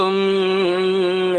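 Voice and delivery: long, level nasal "hmm"; mantra hum. Retold or imitated. Dasappa eyes shut saying a mantra, mock chanting hum. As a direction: drone a drawn-out hum like a chant. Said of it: "ಅಂತ ಏನೋ ಮಂತ್ರ ಹೇಳ್ದ".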